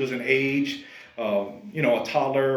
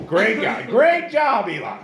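much higher, loud mock praise; sarcastic praise; 9 semitones higher, 5 dB louder, slower than usual. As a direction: praise him with heavy sarcasm.

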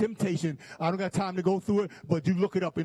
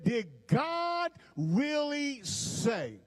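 slow, drawn-out preached question, pitched higher; preaching; 6 semitones higher, much slower than usual. Shown as a demonstration. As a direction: stretch every word like a preacher building up. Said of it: "I feel my preach coming on".

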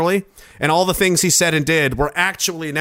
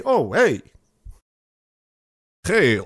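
usual speaking voice

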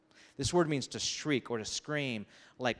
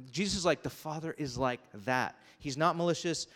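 ordinary delivery

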